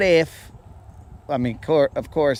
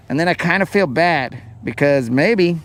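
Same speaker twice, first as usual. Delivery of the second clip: ordinary talk, nothing marked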